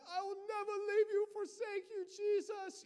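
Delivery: high, wailing imitation of crying; mock tearful vow. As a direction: overwrought youth camp sobbing, hammed up. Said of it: "like, pouring your eyes out".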